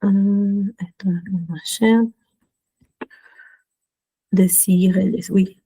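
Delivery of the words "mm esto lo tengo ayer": whisper. Real, not acted. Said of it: "to herself".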